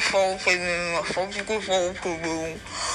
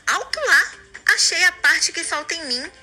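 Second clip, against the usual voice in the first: excited; 8 semitones higher than usual. Acted. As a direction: burst out with joy. Excited at finding the part.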